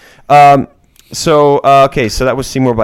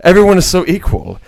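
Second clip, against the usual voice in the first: hammed-up pompous mockery, pitched up in places; mock a self-important snob, putting it on thickly; pretentious; 2 semitones higher than usual. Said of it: "he's pretending to be a pretentious".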